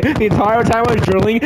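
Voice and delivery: normal talking voice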